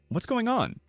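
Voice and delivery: suddenly finding himself back in the cell; surprised. A narrator's reading. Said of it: "said in surprise".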